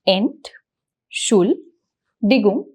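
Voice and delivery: Ent, Schul, Digung said as separate beats; syllable by syllable. Shown as a demonstration. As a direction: split it into slow syllables with pauses. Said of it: "break down the syllables".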